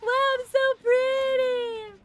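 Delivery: high, loud, slow gushing over herself; mock vanity. Streamer showing off her outfit in a hammed-up vain squeal.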